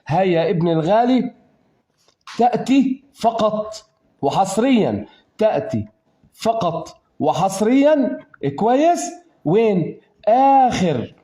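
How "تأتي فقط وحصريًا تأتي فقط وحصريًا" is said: تأتي فقط وحصريًا said slowly, with every word leaned on; slow insistence. Shown as a demonstration. Teacher insisting to the student, slow and firm. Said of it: "teacher insists, repeats phrase".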